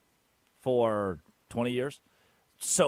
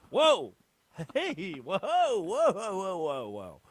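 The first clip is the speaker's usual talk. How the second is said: startled whoa; 5 semitones higher, 3 dB louder, much slower than usual. Real, not acted. Startled exclamation.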